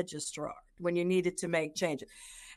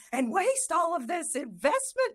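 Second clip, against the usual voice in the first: much higher, hammed-up disapproving mother; mock scolding parent; 10 semitones higher than usual. Imagining her mom scolding her about tuition, mock high voice. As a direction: toss off the reproach she never made, mocking. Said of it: "she didn't say".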